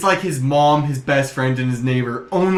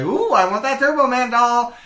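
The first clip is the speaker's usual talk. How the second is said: high-pitched, eager movie rival; hammed-up eager wanting; Sinbad; 9 semitones higher than usual. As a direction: covet it in a put-on high voice. Acts Sinbad in film.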